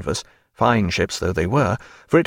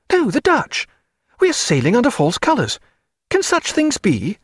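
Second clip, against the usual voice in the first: a higher, fluttering clergyman's voice; nervous parson; 12 semitones higher than usual. Acted. Chaplain frets to Stephen about the ships, high and fluttering. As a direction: play the timid chaplain, fussing.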